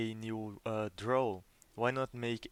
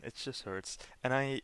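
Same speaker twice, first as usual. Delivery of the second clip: usual speaking voice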